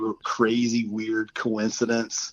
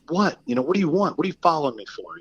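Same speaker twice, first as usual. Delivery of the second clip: mock tough guy; 7 semitones higher, faster than usual. Confronting whoever followed him from the parking lot, mock tough. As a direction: challenge them in a raised, put-on tough voice. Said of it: "trying to be like Billy Badass".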